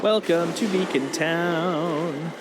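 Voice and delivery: slow grand welcome; mock announcer. Player welcomes his friend to Beacon Town grandly. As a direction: announce it like a theme park.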